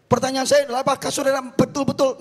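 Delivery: high, loud, emphatic challenge to the congregation; impassioned preaching. Real, not acted. (khotbah lantang menggugah)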